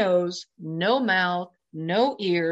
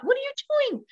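jumping way up in pitch, alarmed; incredulous inner voice; much faster than usual. Retold or imitated. Asking himself aloud, a high alarmed disbelief. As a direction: shock at yourself mid-step. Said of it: "And I thought".

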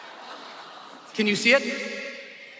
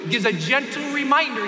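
usual speaking voice

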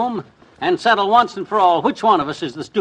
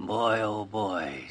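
chuckling; 6 semitones lower, 10 dB quieter, much slower than usual. Acted. Chuckling over scheme.

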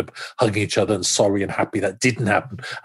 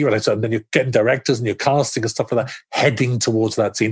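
normal talking voice

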